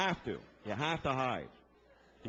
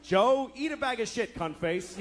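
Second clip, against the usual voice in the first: put-on kid insult; 6 semitones higher than usual. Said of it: "What's your name".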